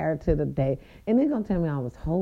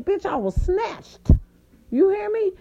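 excited high brag; 13 semitones higher, 3 dB louder than usual. Real, not acted. Bragging about her outfit.

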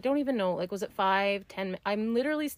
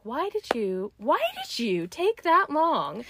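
self-scolding; 8 semitones higher, slower than usual. Retold or imitated. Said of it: "I was mad at myself".

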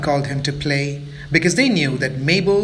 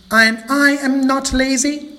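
indignant protest; 9 semitones higher, 4 dB louder than usual. Acted. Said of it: "said Mabel angrily".

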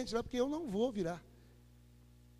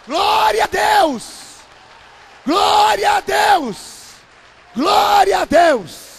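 slow, loud, high cry of glory; preacher's ecstatic praise chant; shouted praise; 9 semitones higher, 20 dB louder, much slower than usual. Real, not acted. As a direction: stretch each glória out to the crowd.

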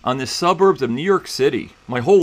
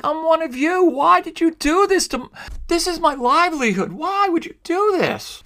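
imitating tearful plea; 13 semitones higher than usual. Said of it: "And she's in tears".

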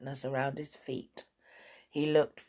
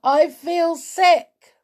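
high, loud, slow moan of complaint; drawn-out high whimpering cry; miserable wail; 13 semitones higher, 9 dB louder, slower than usual. A narrator's reading. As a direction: wail it queasily. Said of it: "wailed Sailor".

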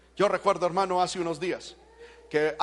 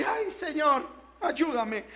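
small whiny mock plea, higher; weak plea; 7 semitones higher, much slower than usual. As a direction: imitate a lukewarm believer begging. (súplica quejumbrosa imitada, aguda y suave)

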